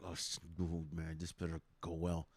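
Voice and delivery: soft, low muttered dread under the breath; worried mutter. Retold or imitated. Seated by dogs on a plane, a soft low worried mutter. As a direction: grumble quietly to yourself, bracing for trouble. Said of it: "I'm like".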